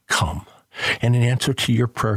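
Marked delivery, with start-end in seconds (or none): 0.1-0.5 lower, gentle single word of invitation; Jesus calling. Acted. Preacher voices Jesus calling Peter, low and gentle. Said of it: "To Peter, he says".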